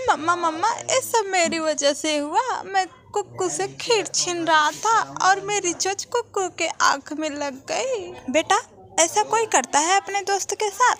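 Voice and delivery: a hint of a guilty little boy; child confessing. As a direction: own up to mom about hurting your friend.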